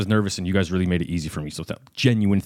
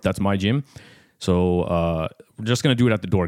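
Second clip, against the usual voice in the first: ordinary talk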